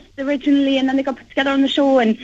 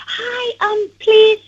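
shy Japanese fan; 8 semitones higher, 3 dB louder, much slower than usual. Retold or imitated. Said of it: "they'll be like".

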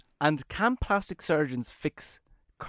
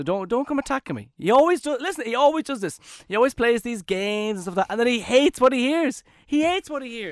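much higher and louder, indignant protest; exasperated complaint; 8 semitones higher, 5 dB louder than usual. Real, not acted. Telling the listeners his co-host always starts this, exasperated protest. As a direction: complain loudly that he's always picked on.

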